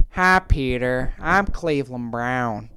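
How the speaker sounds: low, slow cartoon character impression; Cleveland Brown. Voice message to cheer up his sister, low character impression. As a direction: drop the pitch and stretch every word. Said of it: "It's not even a good impression".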